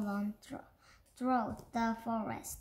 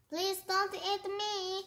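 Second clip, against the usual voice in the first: pleading cry; 8 semitones higher than usual. Acted. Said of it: "cried Beanie".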